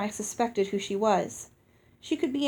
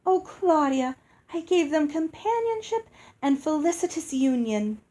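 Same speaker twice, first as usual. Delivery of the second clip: much higher, light and sing-song; musical fairy voice; 6 semitones higher than usual. A narrator's reading. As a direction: answer sweetly, proud of yourself.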